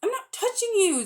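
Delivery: high, indignant denial; boy protesting. A narrator's reading. Diggory protesting to a screaming Polly, high and indignant. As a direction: insist you're not touching her.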